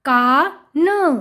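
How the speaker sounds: का drawn out, then a separate न; sounding out. Shown as a demonstration. Teacher reading to children, sound by sound. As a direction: split it slowly: का... न. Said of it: "उच्चारण करके पढ़ लिया".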